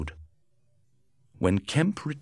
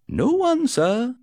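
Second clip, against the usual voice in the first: put-on high, loud servant girl's call from below; maid answering; 7 dB louder, slower than usual. A narrator's reading. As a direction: call up the stairs as the young maid. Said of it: "she answered".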